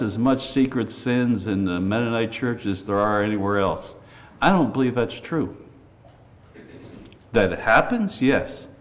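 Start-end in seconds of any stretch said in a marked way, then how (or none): none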